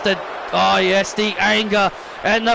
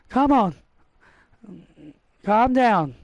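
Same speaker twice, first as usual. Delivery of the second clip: ordinary talk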